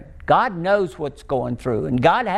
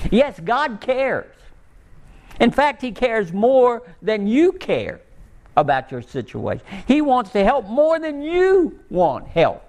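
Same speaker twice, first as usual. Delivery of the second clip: slow, higher emphatic preaching; 6 semitones higher than usual. Acted. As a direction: lean into each promise, slowly.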